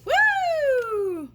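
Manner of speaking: high, loud cheering woo; excited woo. Real, not acted. Cheer after reading.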